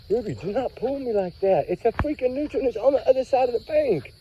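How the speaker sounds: high-pitched, real irritation; exasperated scolding. Real, not acted. Owner telling dog not to pull, exasperated. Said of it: "but I'm getting angry".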